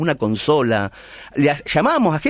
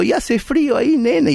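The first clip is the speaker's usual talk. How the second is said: higher, motherly concern; a mother fussing over her son; old mother; 9 semitones higher than usual. Retold or imitated. Old mother worrying about her son in the cold south.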